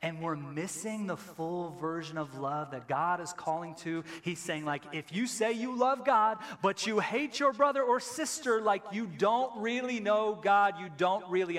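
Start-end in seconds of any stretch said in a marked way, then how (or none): 4.9-11.6 emphatic preaching. Real, not acted. Preaching the verse.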